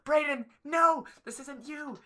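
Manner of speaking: high, drawn-out mock wail; hammed-up mother begging her son to stop; pleading mom imitation. Imitating his mom pleading with Braden, high mock wail. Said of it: "his mom was".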